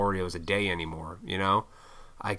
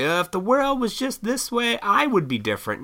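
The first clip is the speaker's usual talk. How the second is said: mock whiner; 12 semitones higher than usual. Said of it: "I used to bitch, moan, and complain".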